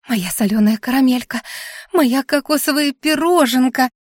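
excited wailing cry. A narrator's reading. Anfisa racing back to the heroine, crying out. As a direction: call out gushingly, climbing on the second name. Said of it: "вопила, совершенно забыв".

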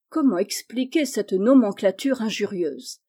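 ordinary delivery, nothing marked

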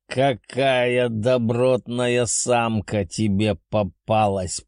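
slow, approving beast voice; amused dragon. Acted. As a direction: praise like a gruff old dragon. Dragon praises the girl.

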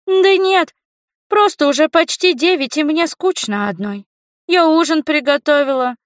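put-on high-pitched child's voice, chatting; young girl on the phone. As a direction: play the kid waiting at home for a grown-up. (высокий голос девочки)

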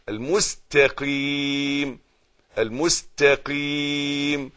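المستقيم slow, with a plain س, not ص; careful reading. Shown as a demonstration. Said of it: "السين مس".

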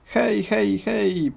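high, jolly hippo voice; Humpi Rumpi. Acted. Hippo greeting the crocodile, high and jolly. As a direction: call out cheerily like a big hippo. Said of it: "powiedział Humpi Rumpi".

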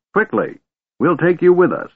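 put-on high-pitched, urgent old man; hurried old man. A narrator's reading. Old man hurries dragon to come along, urgent.

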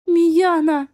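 high, loud sobbing call; sobbing cry. Acted. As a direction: cry out the name through tears. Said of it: "всхлипнула Злата".

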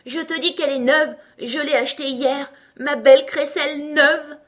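high, loud outraged tantrum; furious shouting. A narrator's reading. Tweedledum wailing about his new rattle, furious. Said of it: "cria Bonnet Blanc plus furieux que jamais".

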